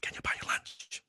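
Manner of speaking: timid whisper of the invitation; quietly asking his idol to lunch. Retold or imitated. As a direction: breathe the question nervously. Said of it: "That one question changed my life".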